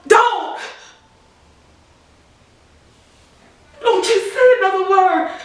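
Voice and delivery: loud, high-pitched cry of protest; anguished yell. Acted. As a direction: yell it out, desperate to stop the news. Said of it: "and I yelled".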